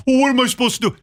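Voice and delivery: high mocking whine; mocking the clueless guy in a high whine; mock whiny guy. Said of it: "And you go".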